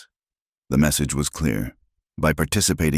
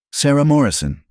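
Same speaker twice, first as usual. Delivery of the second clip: name called high and loud; calling out; 11 semitones higher, 4 dB louder than usual. Acted. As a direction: call her name across the porch. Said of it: "she called out".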